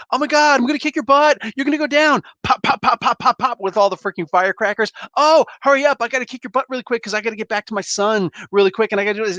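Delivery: hammed-up bratty trash talk, high and quick; mock Jubilee taunts. Jubilee taunting Dazzler mid-fight, high mock chatter. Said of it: "monologuing the entire time".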